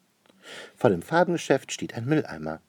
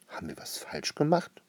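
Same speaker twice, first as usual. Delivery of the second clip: high, softer baffled question; puzzled; 8 semitones higher, 5 dB quieter than usual. A narrator's reading. Franzi asks the upset lady about a mistake, puzzled. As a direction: ask it small, high and puzzled. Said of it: "fragt Franzi verdutzt".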